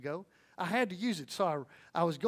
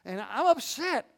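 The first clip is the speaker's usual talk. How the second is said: high, squeaky mock-upset clerk voice; imitating upset clerk; 8 semitones higher, 5 dB louder than usual. Clerk telling customer about her bill, mock upset. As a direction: play the frazzled cashier, pitched way up. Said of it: "she said".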